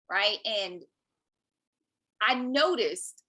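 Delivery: ordinary talk, nothing marked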